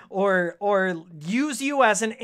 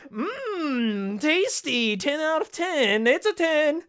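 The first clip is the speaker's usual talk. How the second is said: higher, mocking food-review voice; mock lazy reviewer; 7 semitones higher, slower than usual. Streamer mocking lazy reviewers, higher mock rave. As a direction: lampoon a lazy reviewer in his car. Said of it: "just being like".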